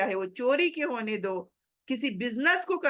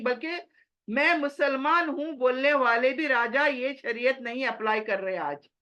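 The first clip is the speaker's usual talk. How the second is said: ordinary talk, nothing marked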